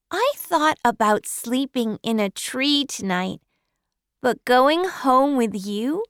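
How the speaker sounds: put-on high bright child's voice, glad to be met; eager little girl. Orphan girl greets Matthew, high and happy child voice. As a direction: play an orphan girl thrilled to be collected.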